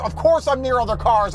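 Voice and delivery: ordinary delivery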